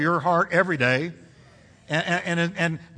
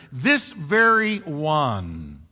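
"This very one" drawn out very slowly; emphatic; 3 dB louder, much slower than usual. Shown as a demonstration. Preacher rendering the emphatic word he, "This very one" slow and weighty. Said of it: "is emphatic".

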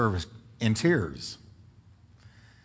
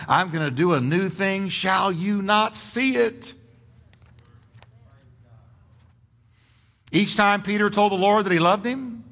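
louder, higher, driving sermon delivery; rousing pulpit proclamation; fired-up preaching; 6 semitones higher, 4 dB louder than usual. Acted. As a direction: preach it with full conviction, punching key words. Preaches at full force.

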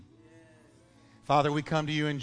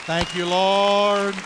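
slow, loud, high thank you Lord; joyful praise shout; 6 semitones higher, 10 dB louder, much slower than usual. Real, not acted.

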